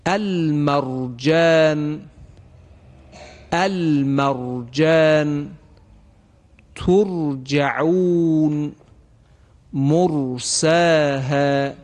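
المرجان، ترجعون، مرساها with thick ر, slow; heavy ra. Shown as a demonstration. Tajwid teacher reciting examples, slow heavy ر. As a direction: recite each word slowly with heavy ر. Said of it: "شما توجه کنید".

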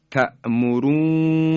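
تأمرونی said slowly with a doubled نون, تأمرونّی; recited ta'murunni. Shown as a demonstration. Teacher reciting the Quran word تأمرونی for listeners.

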